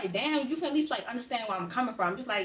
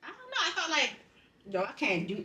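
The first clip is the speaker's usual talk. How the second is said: usual speaking voice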